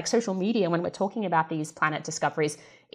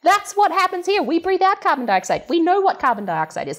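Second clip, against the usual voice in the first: ordinary talk, nothing marked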